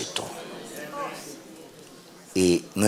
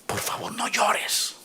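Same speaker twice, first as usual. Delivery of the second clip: soft whispered plea, slow; 7 dB quieter, slower than usual. Acted. God whispering to the weeping preacher, tender. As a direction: breathe it gently like a secret. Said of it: "Y me ha dicho".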